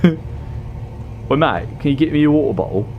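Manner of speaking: high, quick mock British accent; hammed-up British accent, calling on a mate. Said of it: "It's such a good accent".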